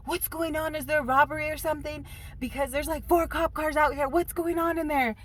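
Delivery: high, loud, alarmed husband voice; confused husband. Retold or imitated. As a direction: sound worried over the phone. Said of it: "And he's like".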